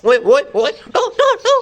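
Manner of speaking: high, squeaky oe oe, no no. Real, not acted. Playful calls to the baby.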